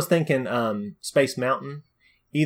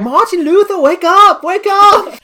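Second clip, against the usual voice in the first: high, loud attempt at a German accent; bad German accent; 11 dB louder, slower than usual. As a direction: shout to wake someone in a hammed-up German accent. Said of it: "that was a German accent".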